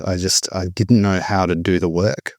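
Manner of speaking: ordinary delivery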